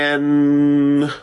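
ordinary delivery

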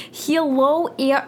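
an Australian accent attempt that drifts off somewhere else, with a rising hello; failed Australian accent. Shown as a demonstration. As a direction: try Australian, miss it badly. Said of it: "that does not sound Australian at all".

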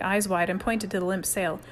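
plain reading voice

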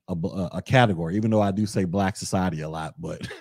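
normal talking voice

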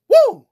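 loud, high whoop of joy; excited whoop. Real, not acted. Celebrating his six hundred YouTube views with a loud whoop. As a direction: whoop out in triumph.

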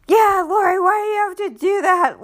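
very high, loud, squeaky mock rant; mocking angry dad. Imitating the dad berating Lori in the car, high angry mock. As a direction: screech it back at her.

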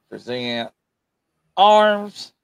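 barked parade-ground order; military command. Real, not acted. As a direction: drill sergeant calling arms. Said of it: "Why did I sound like a damn military fool?".

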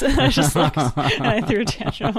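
ordinary talk, nothing marked

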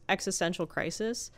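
normal talking voice

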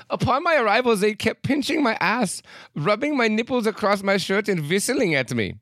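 high mock German accent. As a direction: quote the victim in German accent. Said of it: "saying this in a German accent".

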